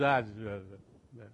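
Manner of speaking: low, soft, slurred stroke-victim mumble; barely form the sounds, slurred and weak; paralyzed mumble. Retold or imitated. Stroke patient mumbling back to her pastor, slurred and soft. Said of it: "she mumbled".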